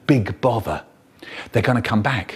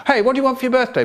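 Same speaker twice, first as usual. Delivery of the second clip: high-pitched coaxing question; high, eager offer; 11 semitones higher, much faster than usual. Acted. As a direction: interrupt brightly to distract him. Trying to distract the chanting brother.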